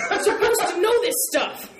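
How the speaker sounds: high, loud mock outburst; hammed-up geek indignation, half laughing; mock angry. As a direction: blurt it in playful outrage. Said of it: "so I was kind of angry".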